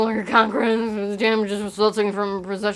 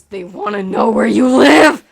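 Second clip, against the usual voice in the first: mock outrage; 11 dB louder than usual.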